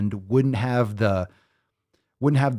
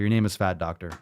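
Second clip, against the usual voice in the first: ordinary talk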